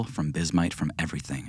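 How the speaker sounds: ordinary talk, nothing marked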